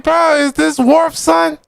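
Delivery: high and slow, in disbelief; high incredulous voice. Retold or imitated. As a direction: squeal it in disbelief. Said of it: "I'm like".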